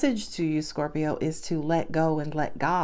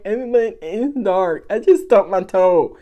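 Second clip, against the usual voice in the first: mock wail, louder toward the end; mock crying; 2 semitones higher, 6 dB louder, much slower than usual. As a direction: whine it like a helpless complainer. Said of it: "you're crying like".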